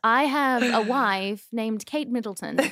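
mock-proper royal English accent; Prince William. Prince praising his wife after birth, mock-royal English accent. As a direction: stretch key words in princely English. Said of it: "do you like Prince William".

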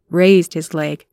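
ordinary delivery

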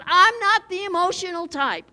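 a hint of a stiff, proud churchgoer; mock stiff church member. Preacher mocking people who claim no emotion, a hint of a stiff scoffer. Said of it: "people all over says".

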